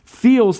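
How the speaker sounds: "feels" stretched slowly for emphasis; stress on feels. Real, not acted. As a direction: dwell on the word "feels". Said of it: "I'm gonna emphasize that word feels".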